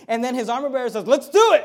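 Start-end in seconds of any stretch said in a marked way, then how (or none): 1.1-1.6 loud, high, eager "let's do it"; eager shout. Acted. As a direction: cheer him on at full voice. Armor bearer cheering Jonathan on.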